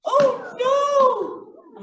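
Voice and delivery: loud, high oh no; mock dismay. Singer crying out at being eaten. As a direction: cry out in playful shock.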